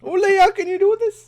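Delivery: high, loud mimicked Newfie; teasing high-pitched Newfie echo; Newfie accent. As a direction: parrot the mom in an exaggerated high Newfoundland accent. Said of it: "That was a great Newfie accent".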